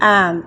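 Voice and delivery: एम said as em, starting on the vowel; teacher sounds out एम for the class; letter M spoken. Shown as a demonstration. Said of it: "एम को कैसे बोलेंगे".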